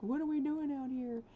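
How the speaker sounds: high voiced confused sims; voicing confused sims. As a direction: give the sims a puzzled high voice. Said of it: "They're like".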